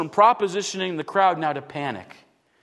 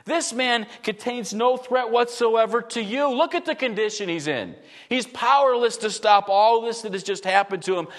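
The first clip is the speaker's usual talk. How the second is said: ordinary talk, nothing marked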